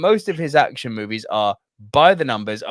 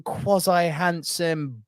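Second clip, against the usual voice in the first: ordinary delivery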